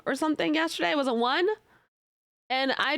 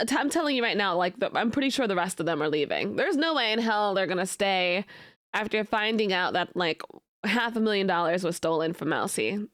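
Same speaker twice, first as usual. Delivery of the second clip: ordinary talk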